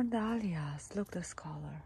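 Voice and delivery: soft breathy whisper. Real, not acted. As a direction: hush it, almost no voice. Hushed over the flowers.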